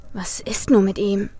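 worried inner whisper; anxious whisper to himself; whispered thought. Acted. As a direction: breathe the thought, barely voiced. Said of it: "dachte Tim".

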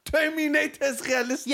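high-pitched, incredulous comeback; sarcastic challenge. Real, not acted. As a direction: hurl it back in high disbelief.

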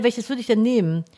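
ordinary delivery

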